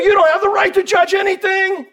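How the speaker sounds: mock accuser, high pitched; mocking the world. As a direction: parody the critic's voice. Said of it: "the world wants to throw us".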